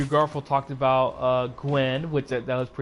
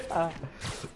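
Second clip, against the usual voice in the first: soft laugh; 9 dB quieter than usual. Real, not acted. Laugh after the quote.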